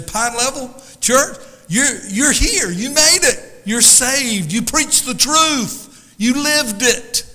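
loud, high, drawn-out acclaim; exultant heavenly greeting, acted out; jubilant welcome. God joyfully greeting the saved church.

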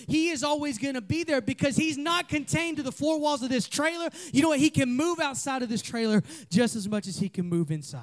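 high, driving, impassioned sermon delivery; passionate preaching. Real, not acted. Pastor preaching God's presence to youth, fired up and high. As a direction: pour it out with building fervor. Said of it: "I'm passionate this morning".

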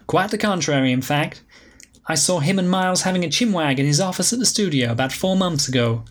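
Alicia, breezy diva. Acted. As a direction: sweep in like a glamorous old flame.

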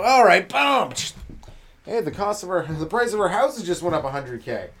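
loud, high triumphant burst; mock gloating rich killer; gleeful rich driver. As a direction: celebrate like you just won money. Rich driver hits poor guy.

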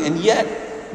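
normal talking voice

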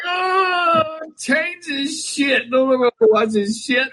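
high, slow, half-sobbing complaint; fake-cry begging to change the channel; mock crying rant. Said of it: "I'm up there like".

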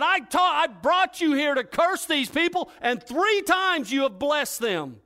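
the king yells that the prophet blessed instead of cursing; furious king. Acted. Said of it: "slaps his hand together out of anger".

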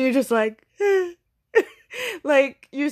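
high laughing break mid-sentence. Real, not acted. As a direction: laugh it out, voice jumping up. Laughing about vanished authors.

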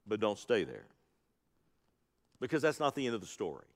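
dropped low and quiet, hurried through; tossed off softly before the real point; soft aside. Acted. Preacher softly telling the church not to linger at the tomb, hushed.

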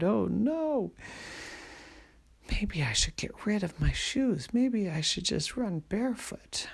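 put-on a worried girl thinking fast; mildly scared girl. Scared Helen in the forest.

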